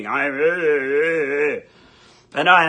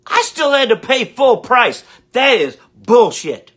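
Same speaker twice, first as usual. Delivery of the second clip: loud, higher mock-furious rant; angry outburst; 5 semitones higher, 7 dB louder than usual. Real, not acted. Ranter venting at viewers, a loud, mock-furious punchline. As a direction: blow up over the Amazon promo code. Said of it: "I get angry".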